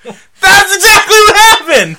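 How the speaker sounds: high, slow, shouted exclamation; laughing, high-pitched yell of recognition; loud amused outburst. Real, not acted. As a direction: cry it out through laughter. Exclaims after the impression, laughing.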